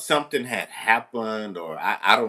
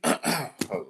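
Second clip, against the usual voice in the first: normal talking voice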